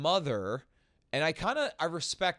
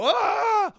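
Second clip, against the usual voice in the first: high, loud imitation scream; hammed-up cry of alarm; mock panicked scream; 11 dB louder than usual. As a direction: scream like a fleeing camera holder. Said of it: "And then they go".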